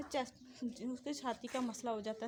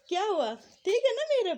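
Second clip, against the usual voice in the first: higher, louder tender coo; 8 semitones higher, 9 dB louder than usual. Real, not acted. Mother turns to the baby.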